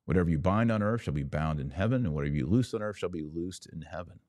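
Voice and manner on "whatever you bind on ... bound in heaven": ordinary delivery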